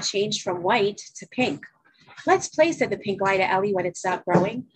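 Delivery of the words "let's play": lie's voice. A narrator's reading. Pink lie voiced in story.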